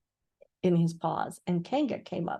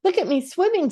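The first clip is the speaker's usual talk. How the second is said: high squeaky joey voice; squeaky little Roo; 10 semitones higher, 4 dB louder than usual. A narrator's reading. Roo calling to everyone from the pool, squeaky and proud. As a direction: squeak it like a proud tiny kangaroo. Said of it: "squeaked Roo".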